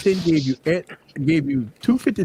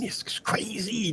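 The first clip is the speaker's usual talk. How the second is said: whispered aside; 5 semitones higher, 10 dB quieter, much slower than usual. Real, not acted. Aside on phone speed.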